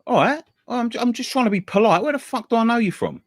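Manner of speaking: imitated high-pitched indignation; aggressive. Taxi driver insisting he is just being polite, mock aggressive. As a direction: protest your politeness, then demand an answer. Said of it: "he's quite, quite aggressive".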